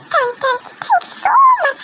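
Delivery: high, drawn-out squeal of thanks; slow, very high grateful squeal; overjoyed squeal. Acted. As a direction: squeal it out in huge relief. Girl relieved she survived.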